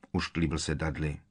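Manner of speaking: usual speaking voice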